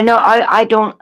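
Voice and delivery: ordinary talk, nothing marked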